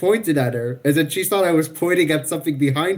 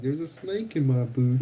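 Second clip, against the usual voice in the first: imitated Southern accent; 2 semitones lower, 5 dB quieter than usual. Teasing a friend about their slips into Southern, imitated Southern accent. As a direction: put on a quiet Southern accent. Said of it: "one day you were like".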